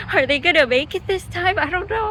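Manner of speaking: high, loud breathless suspense; child on the edge of her seat. Retold or imitated. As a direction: gasp it out like a kid glued to the screen. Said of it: "And I'm like".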